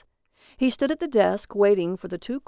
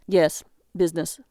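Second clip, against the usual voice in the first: icy; 2 semitones lower than usual. Acted. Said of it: "felt the iciness of Jaron's voice".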